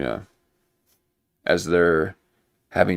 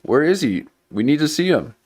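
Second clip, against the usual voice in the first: higher, mock-insistent crowd voice; hammed-up people demanding to see him; demanding public; 8 semitones higher than usual. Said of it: "everyone's like".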